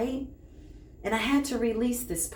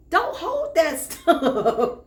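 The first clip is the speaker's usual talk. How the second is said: high, drawn-out words breaking into laughter; amused laughing; 7 semitones higher, 5 dB louder, much slower than usual. Real, not acted. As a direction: laugh through the advice, stretching it out. Laughing at grandmother's grudge.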